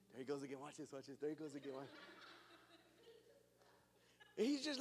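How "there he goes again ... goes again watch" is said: low, soft mock aside; quietly tip off a companion to watch; amused onlooker Jesus. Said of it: "Jesus is just looking at".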